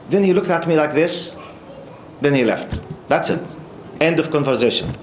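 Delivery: usual speaking voice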